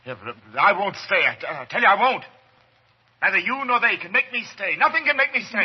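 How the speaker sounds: rising to a frantic yell; panicked shouting. Acted. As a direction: panic building into a desperate shout. Doctor refuses, panicking.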